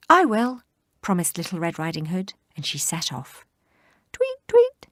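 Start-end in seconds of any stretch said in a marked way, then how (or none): 0.1-0.6 eager promise. Acted. 4.1-4.9 bright tweeting birdsong; high bird chirp. Acted. Birdsong imitation.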